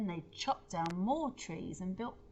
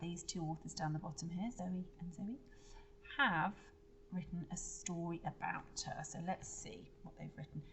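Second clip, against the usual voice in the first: usual speaking voice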